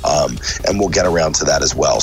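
normal talking voice

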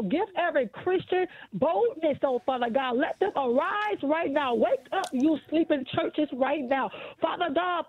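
ordinary delivery

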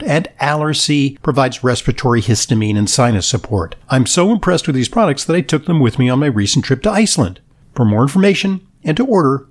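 upbeat sponsor announcement voice; ad read. Acted. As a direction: read it like a polished radio ad.

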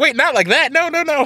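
high, frantic backpedaling protest; mock panic. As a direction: play the horrified critics scrambling to take it back. Mocking critics backpedaling.

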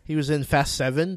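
ordinary talk

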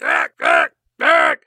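low choked gasping; mock strangled croaks; strangled gasps. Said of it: "he's like".

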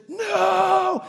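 high, drawn-out acted cry; mock-terrified high yell; panicked shout. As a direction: shout it in imitated night-terror panic. Voices wife shouting in night terror.

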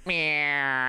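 drawn-out whining plane "meow"; imitated engine noise. Storyteller mimicking the plane going straight up, whining engine noise. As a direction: imitate a stunt plane suddenly climbing. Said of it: "he'd go".

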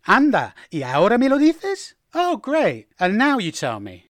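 high, swooping sarcastic exasperation; mock-annoyed disbelief; irritated. Shown as a demonstration. Being told too late, irritated and sarcastic. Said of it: "to express irritation".

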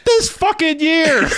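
very high, slow, laughing; ranting at a clueless friend, laughing high; laughing disbelief. Retold or imitated. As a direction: howl it in disbelief. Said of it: "I'm like".

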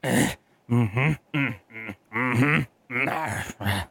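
lightly voiced contented humming; humming on the toilet. The boss humming in the next stall, a hint of contentment. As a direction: hum casually while peeing. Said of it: "and he's like".

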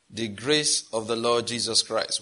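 solemn recital. Real, not acted.

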